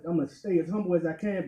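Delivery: usual speaking voice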